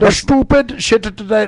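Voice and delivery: lilting Lucky Charms leprechaun voice; sing-song Irish brogue, high; Irish accent. Acted. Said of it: "a fucking little Lucky Charms guy".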